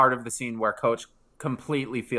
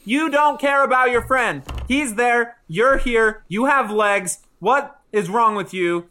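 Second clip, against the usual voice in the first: higher and louder, berating; chewing him out; 10 semitones higher, 6 dB louder than usual. Retold or imitated. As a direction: tear into him like a furious girlfriend. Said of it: "starts chewing him out".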